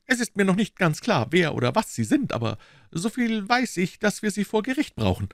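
lightly voiced matter-of-fact inspector; prosaic Gregson. A narrator's reading. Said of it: "sagte der prosaische Gregson". (nüchterner Inspektor, leicht gesprochen)

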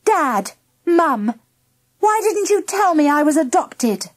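put-on high, insistent girl's question; confronting parents. A narrator's reading. Girl confronts parents, still raised voice.

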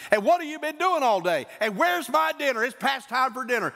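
high-pitched, irritable acted scolding; taking anger out. Husband home upset, scolding his wife, angry. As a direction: come home and pick a fight, voice climbing. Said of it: "taking my anger out on her".

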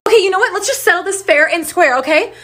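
ordinary talk

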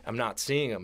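normal talking voice

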